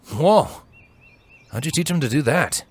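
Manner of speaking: wonder. A narrator's reading. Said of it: "he asked with absolute wonder".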